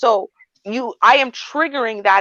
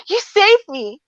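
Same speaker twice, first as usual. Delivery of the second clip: grateful rescued; 10 semitones higher, slower than usual. Retold or imitated. Acting grateful rescued person.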